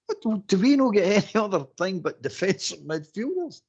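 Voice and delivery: high, incredulous mock whine with a laugh; exasperated fan. Imitating the listeners moaning about defensive midfielders. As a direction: ham up a baffled, fed-up supporter. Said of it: "You're going".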